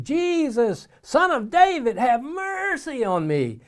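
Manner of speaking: high, loud cry for mercy; acted-out scream for help, last words drawn out; yelled plea. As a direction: yell it out like a desperate beggar. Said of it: "he yells and screams".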